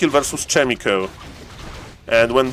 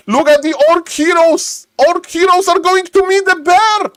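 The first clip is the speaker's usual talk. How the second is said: much higher, near shouting; frantic caster. Real, not acted. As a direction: scream the play as it unfolds. Frantic caster fight call.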